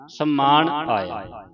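slow, deliberate ਸਮਾਨ as sammaan; doubled m demo. Shown as a demonstration. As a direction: linger on the m in ਸਮਾਨ. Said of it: "ਇਸ ਦਾ ਉਚਾਰਨ ਇਸ ਤਰ੍ਹਾਂ".

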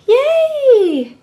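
little toy cheering about nap time; high cheer. Pretend.

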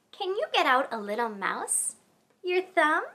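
ordinary talk

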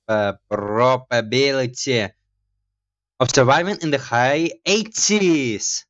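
usual speaking voice

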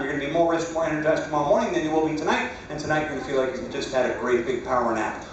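calm hypnotist suggestion voice; steady, lulling post-hypnotic instructions; hypnotized dreamy voice. Acted.

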